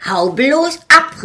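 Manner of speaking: fierce shout at the green hand. Acted. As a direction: drive the monster off with a shout. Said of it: "rief ich".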